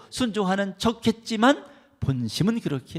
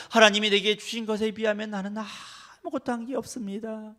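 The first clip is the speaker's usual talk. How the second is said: humble confession; 7 semitones higher than usual. Acted. Humble servant voiced.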